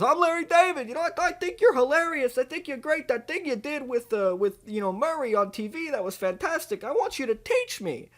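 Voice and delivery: high, eager, gushing voice; Larry David. Acted. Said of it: "and Larry David goes".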